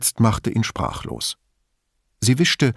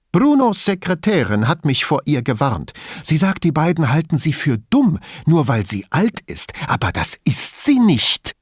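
agitated, higher-pitched protesting; earnest insistence; 6 semitones higher than usual. A narrator's reading. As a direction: insist on it with some heat. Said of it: "beteuerte Elisabetta".

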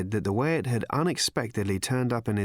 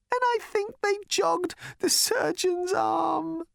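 high, slow, tearful voice; crying; slower than usual. Acted. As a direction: sob the line out. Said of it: "He began to cry again".

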